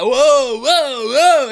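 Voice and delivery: loud, high-pitched mocking "whoa"; mimicking an annoying voice. Vlogger mocks the voice in the clip that triggers her, loud high mimicry. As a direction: hoot it out, mimicking the guy who grates on you. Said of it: "when I hear a voice like that".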